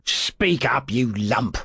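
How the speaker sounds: ordinary delivery, nothing marked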